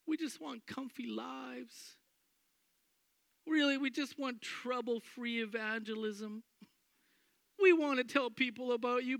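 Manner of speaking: high, whiny frightened pleading; mock fearful prayer. Church imagined begging safety, high whiny fear. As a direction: plead for comfort, half-mocking. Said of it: "And they're going".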